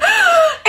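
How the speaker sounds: sharp high gasp of shock; a big dramatic gasp. Retold or imitated. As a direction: gasp like you just saw a scandal. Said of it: "Mia's like".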